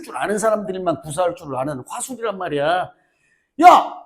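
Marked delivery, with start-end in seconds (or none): 3.6-3.9 loud high 야! to grab the class; sharp call. Real, not acted. Teacher yells at noisy students, loud sharp call. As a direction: bark 야! at the room.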